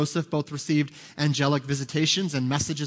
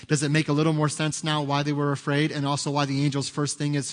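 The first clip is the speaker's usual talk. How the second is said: usual speaking voice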